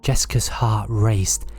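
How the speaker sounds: usual speaking voice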